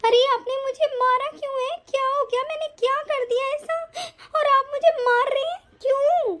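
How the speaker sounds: high, hurt, crying voice; tearful protest. Acted. Wife asking husband why he hit her, crying. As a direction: plead in tearful disbelief.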